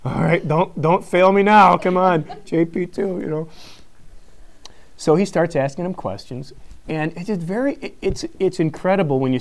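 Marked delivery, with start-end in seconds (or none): 0.0-3.2 pleading prayer, louder and higher; hammed-up desperate prayer; mock prayer. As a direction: beg heaven not to let you down. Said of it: "I was praying, sister".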